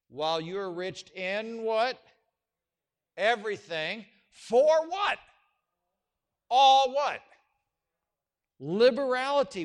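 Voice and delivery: loud reading, shouting what? to the crowd; call and response preaching. Acted. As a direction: pull the congregation in with each what.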